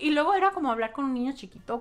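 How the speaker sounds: ordinary talk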